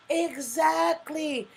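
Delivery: very slow, stretched "Exactly"; drawn-out agreement. Real, not acted. Agreeing with Overseer's comment, stretched slow and high. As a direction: savour the word, stretch it out.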